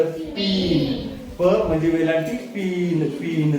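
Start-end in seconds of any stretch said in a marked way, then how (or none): 0.0-1.1 पीन, every sound stretched very slowly; slow model word. Shown as a demonstration. Teacher repeats the word for the class, very slowly. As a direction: drag पीन out so they hear each sound. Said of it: "हे काय झालं?".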